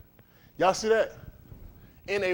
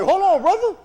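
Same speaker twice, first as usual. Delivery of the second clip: mock plea; 11 semitones higher, 7 dB louder than usual. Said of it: "I'm like".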